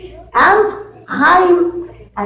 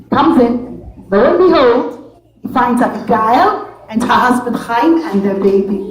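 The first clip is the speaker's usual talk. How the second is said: usual speaking voice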